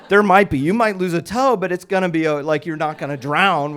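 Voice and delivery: emphatic high-volume rescuer's reply; football voice. Retold or imitated. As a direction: shout down the objection.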